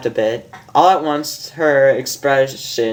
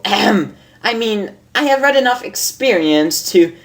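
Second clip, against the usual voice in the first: much higher, amused lady unicorn voice; a refined mare teasing her friend, higher; Rarity; 6 semitones higher than usual. Acted. Reads Rarity's lines in voice.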